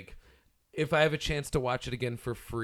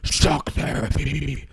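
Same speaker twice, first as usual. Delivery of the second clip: slow, drawn-out villain voice; Electro voice; 3 dB louder, much slower than usual. Acted. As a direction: stretch it out like a cartoon supervillain. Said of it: "do it in the electro voice".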